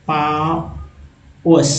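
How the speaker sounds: पाओस drawn out very slowly; very slow model word. Shown as a demonstration. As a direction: stretch पाओस out for learners. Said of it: "next है". (बहुत धीमे पाओस)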